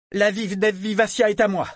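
proclamé très fort et aigu; ton de commandement. Acted. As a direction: commande comme un capitaine. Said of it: "prendre un ton de commandement". (ton de commandement)

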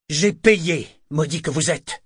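loud, high shout at the man; furious shout. Acted. Kenit throttling the artist and yelling, furious shout. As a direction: yell it while shaking him by the throat. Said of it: "cria-t-il".

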